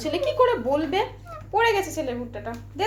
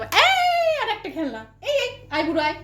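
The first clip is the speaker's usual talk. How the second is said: laughing, retelling; 7 semitones higher than usual. Retold or imitated. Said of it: "আমি তো আগের দিনে বলেছিলাম".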